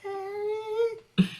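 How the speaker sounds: long, very high mock wail; loud squealed echo of the name; imitated call for Harry. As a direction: stretch the name into a high, put-on cry. Echoes the mimicked call, higher.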